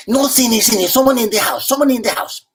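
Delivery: urgent whisper-turned-plea to the host; worried Cuban mother. Retold or imitated. Cuban mother-in-law warning that someone's in the house, urgent. Loli insists again.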